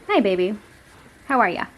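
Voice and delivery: tender pet greeting; cooing at the cat. Real, not acted.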